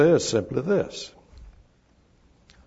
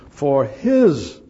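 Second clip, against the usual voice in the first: "his" pushed out louder; stress on his; 2 semitones higher, 8 dB louder than usual. Shown as a demonstration. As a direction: throw "his" forward for emphasis. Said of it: "the word his is emphatic".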